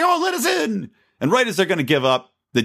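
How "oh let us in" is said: much higher, hammed-up plea; mock pleading. Podcaster mocking the ad's mother and daughter, high hammed-up begging. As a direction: squeak it like a desperate migrant in an ad. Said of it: "They say".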